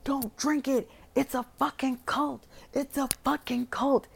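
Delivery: imitating a panicked man yelling them off the drinks; frantic warning. Will begs the guests not to drink, frantic warning yell. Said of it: "Will goes fucking crazy".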